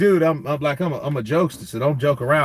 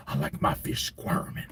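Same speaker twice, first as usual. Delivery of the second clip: low whisper, creepy and close; whispered creepy threat; menacing whisper; 10 semitones lower, 9 dB quieter than usual. Retold or imitated. Terry Crews line, menacing.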